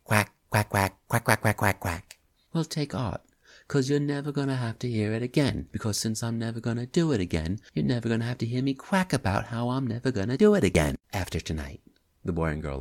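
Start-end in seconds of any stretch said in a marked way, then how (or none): 0.0-2.2 louder mock duck quacking; playful duck imitation; duck quacks. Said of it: "You sound like a duck". 2.5-11.8 fast, higher, exasperated patter; quick-fire comeback; rapid young man. Acted. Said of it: "a rapid pace".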